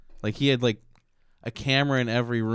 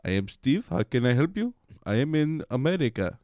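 mock Indian call-centre accent; 3 semitones higher than usual. Tech support agent claiming to be American, Indian accent. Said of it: "he didn't have that accent".

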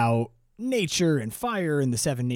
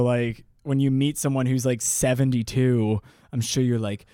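usual speaking voice

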